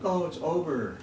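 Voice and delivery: high-pitched mock relief; acted-out naive relief; imitated relief. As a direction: sigh it out high, as if finally done. Voices his past premature relief.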